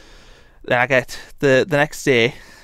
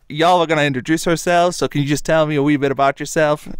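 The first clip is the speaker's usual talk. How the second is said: American counsellor; 3 semitones higher than usual. Retold or imitated. Counsellor welcoming newcomer to the circle, cheery American voice.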